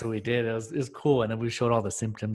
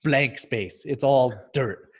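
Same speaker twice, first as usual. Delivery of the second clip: ordinary talk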